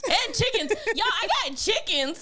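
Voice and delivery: high laughing repeat; laughing echo. Real, not acted. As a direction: laugh it out in disbelief. Echoing friend with laugh.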